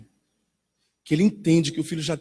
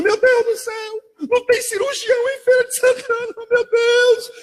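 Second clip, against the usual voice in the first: panicked mother; 3 dB louder than usual. Retold or imitated. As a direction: ham up the frantic mother over a scraped shin. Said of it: "A mãe". (mãe desesperada imitada, bem aguda e arrastada)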